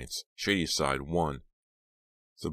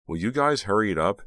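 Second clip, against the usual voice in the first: uncomfortable; 5 semitones higher, 7 dB louder than usual. Acted. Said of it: "Dina said uncomfortably".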